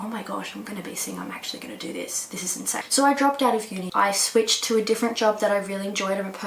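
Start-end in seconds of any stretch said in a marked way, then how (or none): 0.0-2.8 breathless near-whisper of disbelief; hushed thrill of a dream coming true; hushed excited whisper. Retold or imitated. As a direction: whisper it like a secret you can't believe. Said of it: "I was like".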